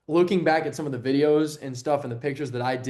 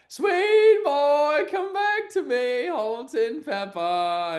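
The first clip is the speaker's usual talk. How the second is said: put-on much higher, slower crooning sweet boy call; mock lover voice; 13 semitones higher, 4 dB louder, much slower than usual. Friend teasing him as a lover or grandmother, in a high crooning voice. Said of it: "he was like".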